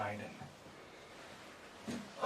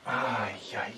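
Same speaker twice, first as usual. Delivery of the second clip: ordinary delivery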